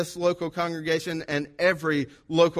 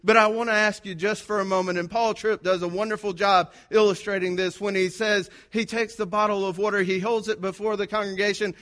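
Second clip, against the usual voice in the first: higher, emphatic sermon voice; preaching; 5 semitones higher, 4 dB louder than usual. Acted. Pastor telling the congregation they are God's witnesses, preaching. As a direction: drive the point home to the pews.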